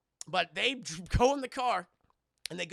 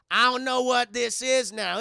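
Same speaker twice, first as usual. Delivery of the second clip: loud fussy complaint; 4 semitones higher, 11 dB louder than usual. Retold or imitated. Chad fussing over lunch food.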